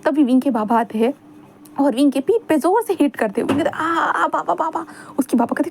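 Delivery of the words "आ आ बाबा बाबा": higher yelp with a laugh; hammed-up ouch as he is hit; mock pain yelp. A narrator's reading. Wing yelps half-laughing as his father hits his back.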